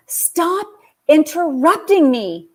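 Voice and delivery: high, exasperated plea; imitating the teen telling mom off; frustrated daughter. Daughter telling mom to stop interrupting, high and exasperated. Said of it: "And she goes".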